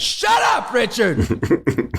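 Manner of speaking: high mock yell of a movie line; imitating a shout. Imitating the character yelling at Richard. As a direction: bark it like the angry character. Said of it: "that was great".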